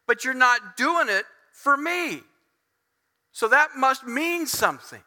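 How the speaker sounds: hurt, emphatic appeal; aggrieved complaint to God. Acted. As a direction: plead the case, leaning on each point. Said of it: "so he reverts and he goes".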